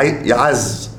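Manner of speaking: يعز said ya-AZZ, with an a after the ayn, slowly; fatha on the ayn. Shown as a demonstration. Teacher showing the verb meaning strength, يعز with fatha on the ayn. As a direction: put a short a on the ayn in يعز. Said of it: "بفتح العين".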